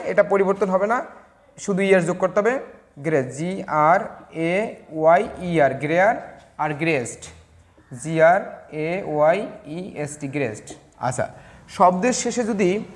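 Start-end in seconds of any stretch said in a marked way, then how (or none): 3.2-5.7 slow, letter by letter; spelling out. Shown as a demonstration. Teacher spelling the comparative of grey, slow spelling out. 8.0-10.3 slow, letter by letter; spelling out. Shown as a demonstration. Teacher spelling the comparative of grey, slow spelling out.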